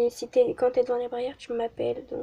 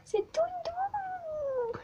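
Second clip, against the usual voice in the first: much higher, slow and soft; high sing-song; 5 dB quieter, much slower than usual. Real, not acted. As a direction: coo it softly. (voix aiguë chantante)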